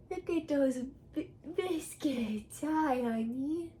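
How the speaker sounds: soft cooing pet voice; cat mom voice. Real, not acted. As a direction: coo sweetly at the kitty. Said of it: "my cat mom voice".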